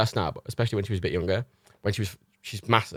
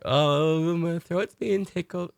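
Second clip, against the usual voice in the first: slow, dopey dog voice with a hint of higher pitch; Matilda impression; 6 semitones higher, much slower than usual. Imitating the dog Matilda, slow and dopey. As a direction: drawl it out like a dozy, contented dog. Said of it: "My impression, my impressions of Reagan and Matilda".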